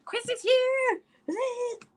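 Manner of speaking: high, squealing greeting; mock shriek of joy at a friend arriving; excited greeting. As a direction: squeal her name with delight. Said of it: "I'm like".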